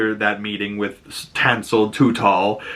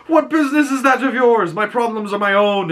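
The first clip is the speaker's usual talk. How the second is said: much higher, put-on voice, mock huffy; defensive Dunk; 9 semitones higher than usual. Dunk snapping at Egg, hammed-up huffy voice. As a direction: brush the kid off in a hammed-up voice.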